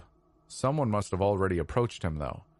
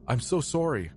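higher-pitched apologetic stewardess; pleading apology; 7 semitones higher than usual. A narrator's reading. Stewardess apologizes to the passenger she hit, pleading. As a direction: plead for forgiveness in a raised pitch. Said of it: "she pleaded".

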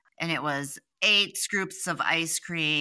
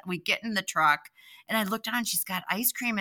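ordinary delivery

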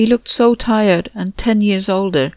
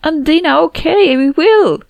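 loud; 10 semitones higher, 7 dB louder than usual. Acted. Answering loud telepathic voice.

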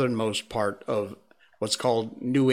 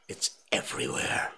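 whispering; 12 semitones lower, 5 dB quieter than usual. Real, not acted. Aside about the hair.

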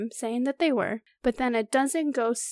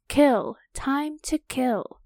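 slow, drawn-out menacing voice; menacing hiss; 2 semitones higher, 4 dB louder, much slower than usual. A narrator's reading. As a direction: stretch each word, a creature hungry to kill. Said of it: "The voice was getting fainter".

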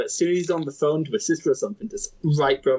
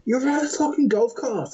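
half-raised voice mimicking an angry stranger; retold shout; 8 semitones higher than usual. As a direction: imitate an irate golfer shouting. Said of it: "some guy was shouting".

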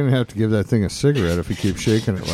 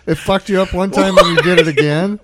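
laughing; 6 semitones higher, 6 dB louder than usual. Real, not acted. Laughing at friend sniffing again.

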